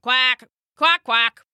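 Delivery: a duck answering Jacob, quacking. Acted. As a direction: quack back like a friendly duck. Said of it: "the duck said back".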